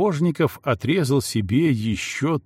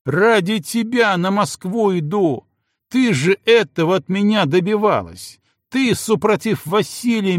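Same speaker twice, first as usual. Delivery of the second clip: гневный крик; 8 semitones higher, 4 dB louder than usual. Acted. Муж кричит в ссоре.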